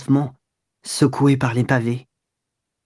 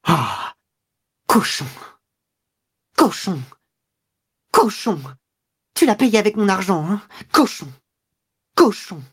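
disgusted fury, raised pitch; insulte crachée; 5 semitones higher than usual. A narrator's reading. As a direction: spit the insult out in separate bursts. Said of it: "elle expectora avec le mouvement qu'on fait pour cracher". (insultes crachées avec rage)

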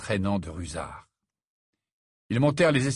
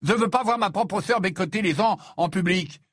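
high, heated brotherly reproach; raised-pitch angry complaint; indignant scolding; 7 semitones higher, 4 dB louder than usual. A narrator's reading. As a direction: scold your sister in outrage. Ron scolds his sister.

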